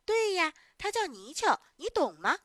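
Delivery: challenging chime-in. Acted. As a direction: chime in loudly, showing off what you know. Other children chime in, challenging.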